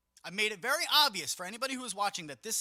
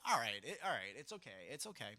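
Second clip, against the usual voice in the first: soothing reassurance; 6 semitones lower, 5 dB quieter than usual. Pretend. Comforting a sorry kid or pet.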